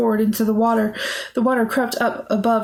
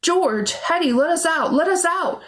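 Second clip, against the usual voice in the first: high, urgent calls, climbing higher; raised, frantic pleading; shouting; 6 semitones higher than usual. A narrator's reading. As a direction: shout more desperately with each phrase. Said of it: "George shouted".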